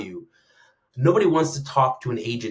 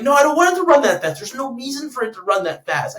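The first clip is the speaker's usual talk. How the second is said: high, loud objection; exasperated protest; 12 semitones higher, 7 dB louder than usual. Acted. Pleading against superfast robots, exasperated protest. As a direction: protest in raised, incredulous voice. Acts out own alarmed objection.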